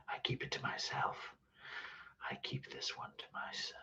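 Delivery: barely-there voiceless whisper; secretive whisper. Pretend. Streamer keeping one to himself, whispered secretively.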